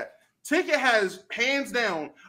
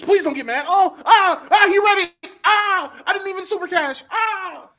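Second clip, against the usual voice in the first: high, loud hammed-up cries of terror; mock panic; 8 semitones higher, 6 dB louder than usual. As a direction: plead and wail in pretend fear, half laughing. Mock fan panicking at Ticket's reaction.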